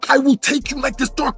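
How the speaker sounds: hammed-up threat to a friend; threatening Red. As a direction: play the big guy threatening. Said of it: "He's like".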